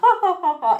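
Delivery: high, loud "ha, ha, ha"; put-on polite guffaw; fake social laugh. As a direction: imitate a superficial dinner-party laugh. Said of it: "they all kinda laugh".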